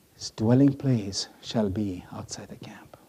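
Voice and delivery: hushed, breathy reading of the law; whisper. Acted. Preacher reading the leper law to the congregation, whispered. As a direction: whisper the banishment like a verdict.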